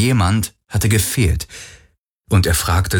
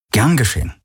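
lightly higher, put-on easygoing; feigned casualness; 5 semitones higher, faster than usual. A narrator's reading. He brushes off her thanks for helping, feigned casualness. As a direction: play it casual, as if it were nothing. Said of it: "gespielt locker".

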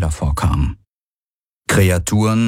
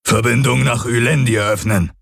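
lightly voiced alien command; calling out; 3 semitones higher than usual. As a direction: call it out to the hall. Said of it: "rief der Aur".

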